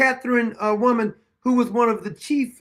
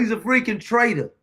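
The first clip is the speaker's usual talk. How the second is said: usual speaking voice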